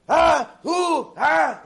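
high, very loud frantic cries; losing his mind. Retold or imitated. Guest realizing his money is gone, acted frantic yelling. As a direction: yelp each word in hammed-up panic. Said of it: "he starts losing his mind".

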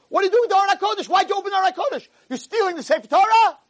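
high, rapid-fire outraged questioning; acted furious rabbi; angry accusation. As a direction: accuse a thief at full tilt. Rabbi accuses man of stealing.

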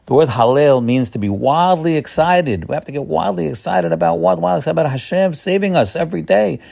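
high, rousing sermon voice; preacher whipping up the crowd, pitched high; fired-up preaching. Acted. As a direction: urge the congregation to get wildly excited. Urging congregation to wild excitement.

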